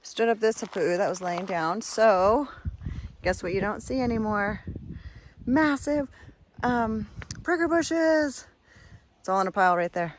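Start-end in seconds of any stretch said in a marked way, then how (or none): 5.5-8.5 excited. Real, not acted. Excited reveal of cleared bushes.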